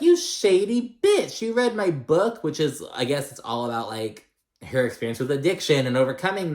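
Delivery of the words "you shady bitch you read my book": much higher, slow mock outrage, imitating; indignant Leah. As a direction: drag out the shock, hammed-up. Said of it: "And then Leah's like".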